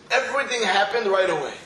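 ordinary delivery

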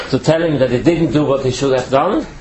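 usual speaking voice

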